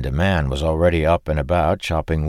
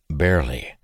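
plain reading voice

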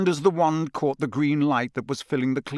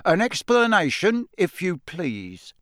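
stern official; 6 semitones higher, 3 dB louder than usual. Acted. Diggory interrogating the elf.

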